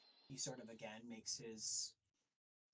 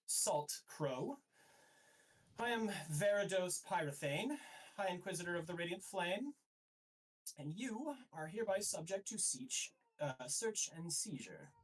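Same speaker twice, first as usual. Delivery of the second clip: higher-pitched, formal official's proclamation; proclaiming inquisitor; 6 semitones higher than usual. Acted. High Inquisitor declares a search of the ship, formal proclamation. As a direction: announce your authority as an inquisitor. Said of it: "and goes".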